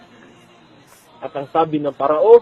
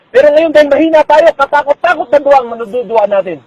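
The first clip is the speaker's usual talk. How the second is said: preaching shout; 7 semitones higher, 6 dB louder than usual. Real, not acted. Preacher builds to a peak.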